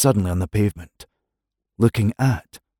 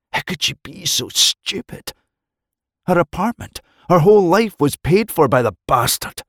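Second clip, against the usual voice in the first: much higher, rising distress; agitated narration; 9 semitones higher than usual. A narrator's reading. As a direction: voice climbing in dismay. Narration of her self-disgust.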